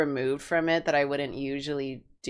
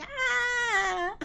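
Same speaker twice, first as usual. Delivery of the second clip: cat cry; 3 dB quieter than usual. Acted. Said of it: "All she needs to be doing is going".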